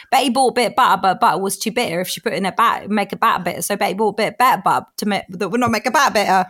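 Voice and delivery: fast London tongue twister with every T dropped; proper London accent. Shown as a demonstration. Kids make her do tongue twister, London accent dropping T's. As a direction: rattle off "butter" and "bitter" London-style, T's as glottal stops. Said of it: "proper London, where you drop all your T's".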